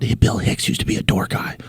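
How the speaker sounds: imitating awestruck whisper; hushed tones. Young comics trading lore about the Comedy Store, hushed tones. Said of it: "like, with hushed tones".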